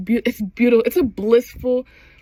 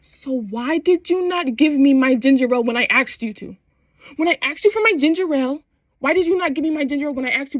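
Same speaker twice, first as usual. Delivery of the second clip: mock outrage speeding into a rant; indignant; 3 semitones higher, 3 dB louder, faster than usual. Customer confronting bartender over ginger ale, indignant.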